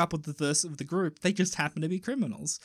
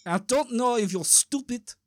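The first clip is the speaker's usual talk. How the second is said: put-on Russian accent. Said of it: "the Russian guy goes".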